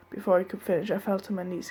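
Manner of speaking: ordinary delivery, nothing marked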